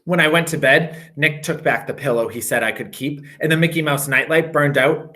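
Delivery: usual speaking voice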